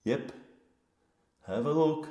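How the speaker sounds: ordinary talk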